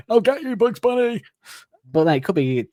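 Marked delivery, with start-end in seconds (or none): 0.1-1.2 much higher cartoon hunter threat; Elmer Fudd voice. Elmer Fudd threatening Bugs Bunny, high squeaky cartoon voice. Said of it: "and he goes".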